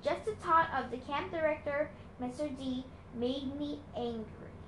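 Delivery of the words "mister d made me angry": ordinary delivery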